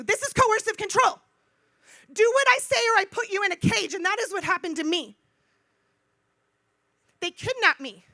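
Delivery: loud, high, emphatic indignation; fired-up public denunciation; impassioned outrage. Real, not acted. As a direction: raise the voice and hammer every phrase. Impassioned outrage at being caged.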